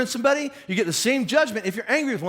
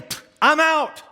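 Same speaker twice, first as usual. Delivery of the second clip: higher, louder scoff of giving up; fed up quitting; 7 semitones higher, 5 dB louder, slower than usual. Acted. Churchgoer quitting on Jesus with a loud scoff. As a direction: scoff and walk away from it.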